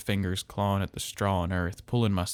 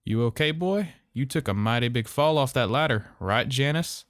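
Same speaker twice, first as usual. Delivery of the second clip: raised, caring father's voice; genuine concern; 5 semitones higher, 4 dB louder than usual. A narrator's reading. Father covering up to Miss Lewis, false concern. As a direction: sound like any good father. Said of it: "His voice had flipped to that of genuine concern".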